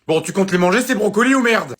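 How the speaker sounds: high, loud snapping threat; exasperated mum. Acted. Mum loses patience over the broccoli, loud. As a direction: lose patience with him.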